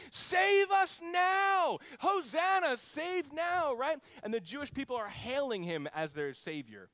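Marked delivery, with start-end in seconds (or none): 0.1-2.8 high, loud, slow cry for rescue; crowd shouting. Retold or imitated. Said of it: "They're just shouting this". 2.8-3.7 "save now" cried out high and loud; crowd shouting. Retold or imitated. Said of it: "So they're saying".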